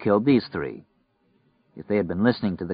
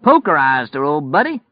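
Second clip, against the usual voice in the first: gleeful, raised-pitch yell; 6 semitones higher, 5 dB louder than usual. Acted. Killer boasting to his partner mid-robbery, yelling. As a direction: yell it back like a whooping outlaw. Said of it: "Polk yelled".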